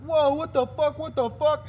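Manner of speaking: loud, high retold outcry; shocked crowd yell. Imitating onlookers after the kid fell over, shocked yelling. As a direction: shout it like an alarmed onlooker. Retelling everyone's shouted reaction.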